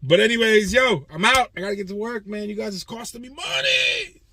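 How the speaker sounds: high, loud hollered goodbye; hyped-up high shout out the door; loud sign-off. Real, not acted.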